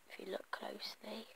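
breathy hushed aside; whispering. Real, not acted. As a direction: whisper it as a little secret. Aside about the candy.

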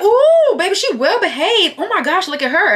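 very high, loud, swooping praise; over-the-top delighted squealing; gushing stranger. Retold or imitated. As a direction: imitate a woman fawning over a dog. Acts out sister admiring the dog.

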